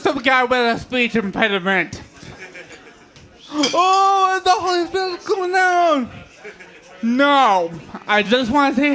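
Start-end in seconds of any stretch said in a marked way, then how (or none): 3.5-6.1 awed churchgoer. Retold or imitated. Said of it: "they think".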